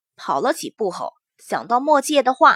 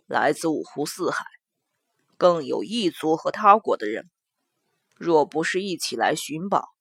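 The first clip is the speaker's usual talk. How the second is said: low, soft grim man's voice; 9 semitones lower, 6 dB quieter than usual. Acted. Mo Jie analysis.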